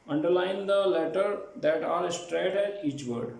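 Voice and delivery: ordinary talk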